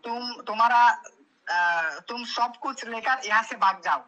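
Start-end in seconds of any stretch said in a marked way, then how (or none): none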